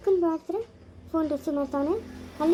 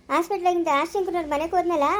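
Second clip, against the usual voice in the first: usual speaking voice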